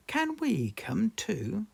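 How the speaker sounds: put-on slow, high eager child; little girl asking hopefully; Peppa voice. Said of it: "Peppa said".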